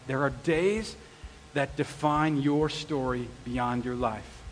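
soft, slow solemn reading; quiet awe of a favorite movie line; hushed reverent quote. Retold or imitated. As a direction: let the line land softly. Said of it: "a quote that she says in the movie".

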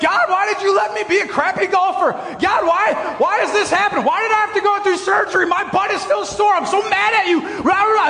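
hammed-up furious shouting up at heaven; yelling at God. Frustrated golfer alone on the course yelling at God. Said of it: "I'm literally yelling at God".

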